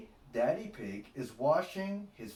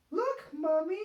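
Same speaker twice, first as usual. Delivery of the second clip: high, louder cheerful piglet; smiling Peppa; 6 dB louder than usual. A narrator's reading. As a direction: show Mommy proudly as Peppa. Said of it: "smiles Peppa".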